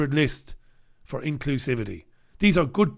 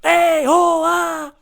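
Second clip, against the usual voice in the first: higher, louder hammed-up yell; mock French yell; 9 dB louder, much slower than usual. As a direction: lose your head, hammed up. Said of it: "the French losing their heads".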